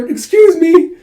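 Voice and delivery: very high, loud squeak; squeaky acted "excuse me"; high-pitched excuse. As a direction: pipe up suddenly, pitch leaping. Acted startled reply to challenge.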